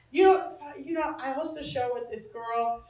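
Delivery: ordinary delivery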